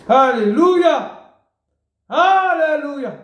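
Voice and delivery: high, slow drawn-out praise cry; exultant alleluia. Real, not acted. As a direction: lift it up in triumphant praise.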